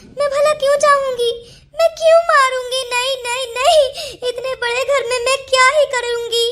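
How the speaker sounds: distressed, wailing protest; crying insistence on innocence; tearful denial. Acted. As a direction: plead through sobs, stretching the endings. Accused woman denying in tears.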